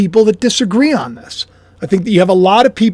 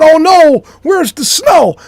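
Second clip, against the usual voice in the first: mock whine; 7 semitones higher, 3 dB louder, slower than usual. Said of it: "I'm also not going".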